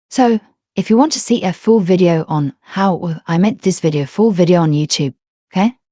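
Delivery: ordinary talk, nothing marked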